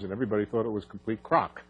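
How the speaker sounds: ordinary talk, nothing marked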